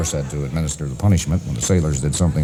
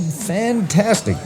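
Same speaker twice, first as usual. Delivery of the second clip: high, very drawn-out rave; mock elated; 12 semitones higher, much slower than usual. Old George raving to his doctor about the medicine, elated. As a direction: stretch it out high with delight.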